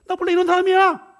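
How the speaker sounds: high, loud defiant yell; shouted retort. Acted. Husband shouting back at his wife, angry defiant yell. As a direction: fling it back at her at full volume.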